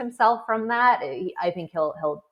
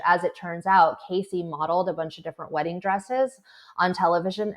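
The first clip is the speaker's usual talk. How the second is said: ordinary talk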